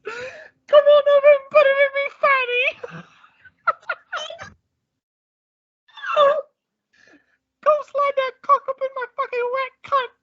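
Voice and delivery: very high, hammed-up falsetto Queen; absurdly high-pitched old-queen impression; Queen of England. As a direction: squeal it as a mock British royal. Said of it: "the Queen of England is just bending over".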